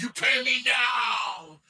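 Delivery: loud, drawn-out mock shout; comedian's shouted demand. Retelling a comedian's Venmo bit, imitated drawn-out shout. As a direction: holler it slowly, stretching the words out. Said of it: "He's like".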